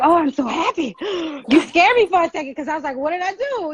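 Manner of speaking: high, gushing relief after a scare; retold relief. Telling a woman she scared me, relieved.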